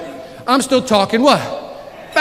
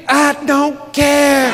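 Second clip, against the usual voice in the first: mock defiance; 5 semitones higher, much slower than usual. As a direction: shrug it off slowly for the laugh. Joking defiance to audience.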